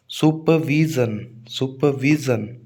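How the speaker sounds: slow supervision, the वी held long; stress on वी. Shown as a demonstration. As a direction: stretch VEE in supervision. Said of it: "वी पर stress आएगा". (Supervision के वी पर ज़ोर, धीरे)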